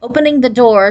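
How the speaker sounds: ordinary delivery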